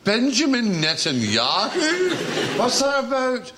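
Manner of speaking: high, slow drawn-out name; Billy Connolly. Retold or imitated. Billy Connolly on the phone, slow high mock riff. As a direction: savour the name slowly, up high. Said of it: "He went".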